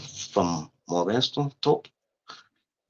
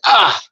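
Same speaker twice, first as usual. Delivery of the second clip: startled yell; 10 semitones higher, 6 dB louder than usual. Retold or imitated. Stabbed in the fight.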